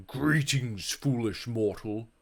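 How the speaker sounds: low, slow, menacing devil voice; devil's greeting. Acted. Satan greeting the ghost, low menacing voice. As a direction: greet him like the lord of hell.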